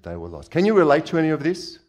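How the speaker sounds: usual speaking voice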